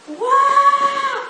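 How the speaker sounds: a loud, high echoing "Wow!"; imitated booming call. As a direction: call out like a voice ringing through a hall. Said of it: "Giống như là một cái giọng tới mà kêu". (tiếng kêu vang vọng bắt chước, cao và to)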